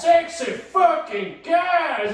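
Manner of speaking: high, loud, drawn-out shout; mock yell. Kids yelling at the teacher in class. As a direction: belt it out slowly like a heckle. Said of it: "we would just yell out".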